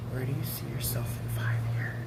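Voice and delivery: a soft whispered question; hushed whisper. Retold or imitated. Recalling Jesus asking her at night, in a whisper.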